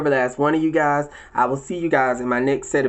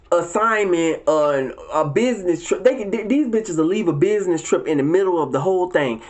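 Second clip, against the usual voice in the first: usual speaking voice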